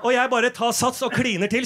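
ordinary talk

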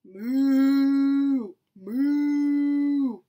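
long, level moo; drawn-out imitation cow moo. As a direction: moo like a cow, holding the vowel. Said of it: "He can sound like a cow".

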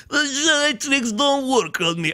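super-exaggerated high alien trader impression; slow, drawn-out, high-pitched caricature voice; Watto. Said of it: "I'm super exaggerating".

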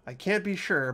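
ordinary talk, nothing marked

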